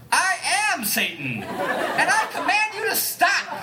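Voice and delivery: high, very slow grand proclamation; mock Satan command. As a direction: declare it slowly like a bad devil. Claiming to be Satan.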